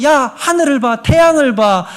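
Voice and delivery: high, loud lecturing push; arguing with evidence. Pretend. Imagined God arguing proof at us, high and pushy. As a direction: argue it like a debater. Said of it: "이런 얘기를 하나님이 ... 않는단".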